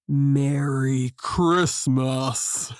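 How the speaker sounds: very low, very slow monster growl; low booming growl. A narrator's reading. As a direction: growl it deep and slow like a beast. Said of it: "growled in a low, booming voice".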